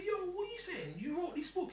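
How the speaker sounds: very high, excited disbelief; can't believe he wrote it; amazed question. Retold or imitated. Asking the author about his book, amazed. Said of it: "I said".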